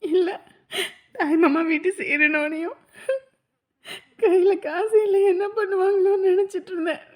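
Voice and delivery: halting words between sobs; crying with relief and gratitude; tearful. Acted. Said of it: "இப்ப போய் அழுதுட்டு இருக்கீங்க". (விம்மி விம்மி அழுதபடி பேசுவது)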